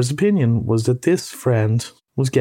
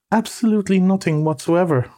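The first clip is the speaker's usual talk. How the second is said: matter-of-fact denial, pitched higher; perfectly truthful; 7 semitones higher than usual. A narrator's reading. Mole answering triumphant rat, frankly truthful. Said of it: "with perfect truthfulness".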